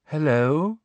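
dreamy, rising hello; vague and dreamy. Acted. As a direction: drift in with an airy hello. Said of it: "a vague and dreamy voice".

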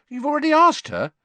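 high, loud, incredulous question; taken aback. A narrator's reading. Ron questioning Fred about Angelina, taken aback. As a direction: squeak it out in astonishment. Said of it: "taken aback".

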